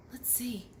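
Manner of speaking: soft whispered aside; hushed storyteller whisper. Acted. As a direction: whisper it to the kids. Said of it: "whisper while reading aloud".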